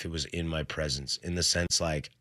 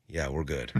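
hushed reply; 7 semitones lower, 9 dB quieter than usual. Retold or imitated. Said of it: "He goes".

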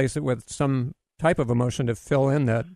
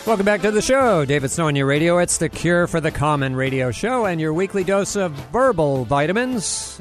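loud, bright show-opener announcer voice; radio announcer; 3 semitones higher, 4 dB louder than usual. Acted. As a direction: welcome listeners back with big energy. Host opens show after break.

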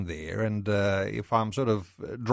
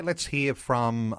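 ordinary talk, nothing marked

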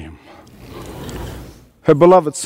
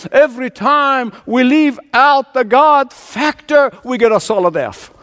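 higher-pitched, driving sermon refrain; impassioned preaching; 6 semitones higher than usual. Real, not acted. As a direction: preach it high, hammering each repeated phrase. Preaching builds to climax.

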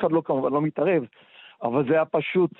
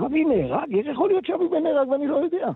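higher, rushing disbelief that builds; shocked disbelief; 11 semitones higher than usual. Retold or imitated. On the phone to his friend's sister, rising stunned disbelief. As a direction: cry out the question as it sinks in. Hearing friend was killed.

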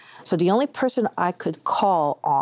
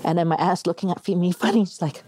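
usual speaking voice

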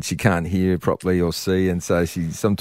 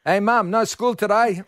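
put-on kid telling mum; 12 semitones higher than usual. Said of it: "he said".

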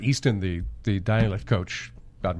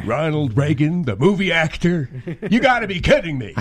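louder, incredulous Nicholson shout; Jack Nicholson as Doc Brown; 5 semitones higher, 4 dB louder than usual. Acted. Doc Brown as Nicholson laughs off Marty's answer. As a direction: blurt out disbelief like Nicholson. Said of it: "Jack Nicholson is Doc Brown".

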